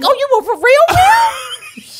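high rising shocked cry; shocked squeal. Retold or imitated. Friend imitating her shocked reaction, high squeal. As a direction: squeal in disbelief. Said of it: "I'm like".

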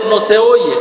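usual speaking voice

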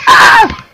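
piercingly high, loud scream; shriek. Real, not acted. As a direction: let out a sudden shriek. Screams in game.

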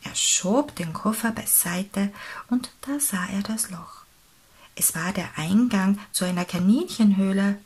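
usual speaking voice

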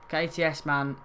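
normal talking voice

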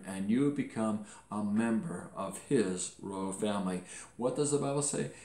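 ordinary talk, nothing marked

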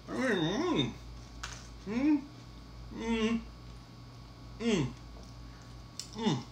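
ordinary delivery

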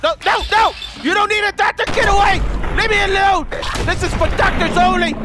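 high, loud, hammed-up outrage; frantic angry yelling. Player chasing someone off from the doctors, frantic yelling. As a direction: shout the intruder away.

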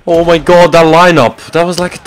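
loud, high amazed outburst; excited. Real, not acted. Gamer reacts to a triple lineup, loud amazed outburst. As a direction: shout it out in amazement.

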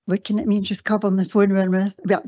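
ordinary talk, nothing marked

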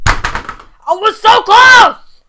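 drawn-out yell of near-miss; frustrated shout. Real, not acted. Gamer just missing the win, real slow shout. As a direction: howl it, slow and loud. Nearly won the round.

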